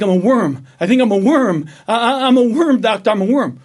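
a desperate patient blurting out his secret; stammering patient building to a loud, high plea to the doctor; frantic worm patient. Acted. Patient tells doctor he's worm.

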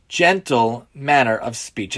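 usual speaking voice

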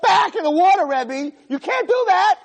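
high, loud horrified protest; imitated class screaming. Students begging the rabbi to save the goldfish, mock screaming. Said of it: "my whole class was screaming".

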